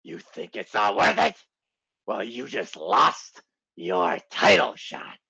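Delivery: slow, low boss growl; hammed-up seething boss, drawn out; Vince McMahon. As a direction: deliver the verdict slowly with mock menace. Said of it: "Vince goes".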